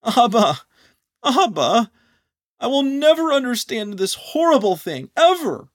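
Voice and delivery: high, loud, anguished wail; anguished cry. Acted. Anon on the floor calling out to Abba, anguished and high. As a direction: cry out in grief and despair.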